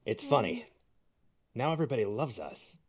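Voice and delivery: ordinary delivery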